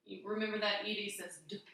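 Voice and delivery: ordinary talk, nothing marked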